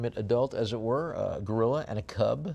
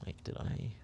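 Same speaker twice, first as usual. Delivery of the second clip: softer, under his breath; whispered realization; 10 dB quieter than usual. Real, not acted. Auctioneer noticing his own slip, whispering to himself. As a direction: catch yourself in a whisper.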